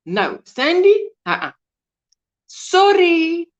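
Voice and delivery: usual speaking voice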